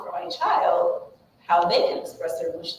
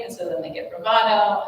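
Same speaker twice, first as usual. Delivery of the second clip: ordinary talk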